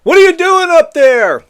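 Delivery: imitating reporters shouting up at the roof; reporters yelling. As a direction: call up to him in mock alarm. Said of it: "Reporters are screaming at him".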